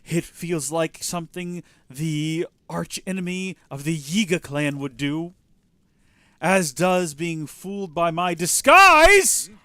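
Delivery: rising menacing villain reveal. Acted. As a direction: build to a loud threat. Voices Yiga villain reveal.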